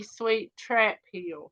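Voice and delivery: very slow, savoured correction; slow, mock-proud correction of her friend; fond correction. Real, not acted. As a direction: stretch out sweet Trap Hill proudly. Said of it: "she comes from Crap Hill".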